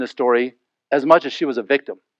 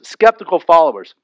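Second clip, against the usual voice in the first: ordinary delivery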